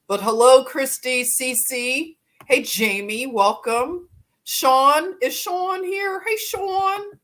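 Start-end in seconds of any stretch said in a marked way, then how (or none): 4.4-7.2 high, drawn-out Philly "Sean" with an aw sound; Philly accent. Shown as a demonstration. Greeting a viewer named Sean in a Philly accent. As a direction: greet "Sean" the Philadelphia way, said "Shawn" with an open aw. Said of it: "That's how you say it in Philly".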